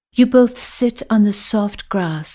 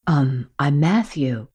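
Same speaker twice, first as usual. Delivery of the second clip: lightly lower, sheepish admission; confessing Matthew; 6 semitones lower than usual. Acted. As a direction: own up that he's lost too. Matthew introduces himself.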